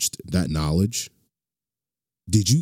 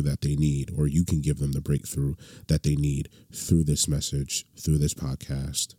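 quiet, earnest prayer voice; 4 semitones lower, 3 dB quieter than usual. Acted. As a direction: pray low and sincere. Opening prayer over the podcast.

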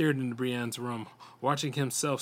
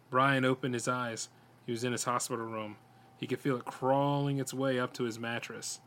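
ordinary delivery, nothing marked

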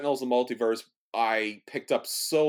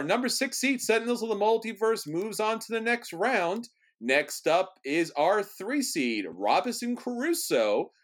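announcer voice; 8 semitones higher, slower than usual. Acted. Host announces bracket matchup.

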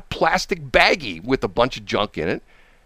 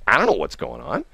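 ordinary delivery, nothing marked

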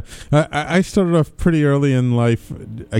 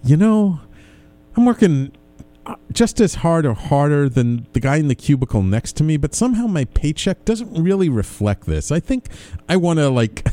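quoting past self; 5 semitones higher than usual. Retold or imitated. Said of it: "I was less like".

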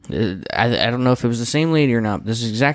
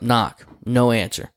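ordinary talk, nothing marked

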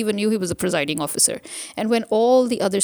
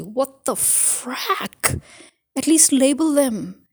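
exasperated outburst; 5 semitones higher, much slower than usual. Real, not acted. Ranting about unlabelled ballot boxes.

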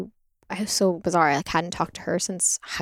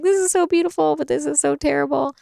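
higher, louder awestruck gush; overwhelmed awe; 8 semitones higher, 3 dB louder than usual. Retold or imitated. Woman recalls reacting to a birth documentary, higher awed voice. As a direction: gush at beauty and horror at once.

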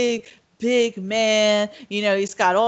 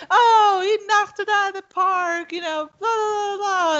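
much higher, gleeful mock cheer with a laugh; happy cheering; 12 semitones higher than usual. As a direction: cheer like the crowd at a ballgame. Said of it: "he gets all happy".

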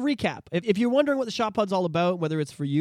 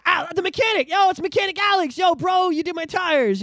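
loud greeting; 12 semitones higher, 5 dB louder than usual. Retold or imitated. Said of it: "Or it's".

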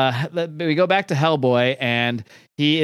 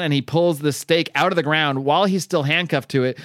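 ordinary talk, nothing marked

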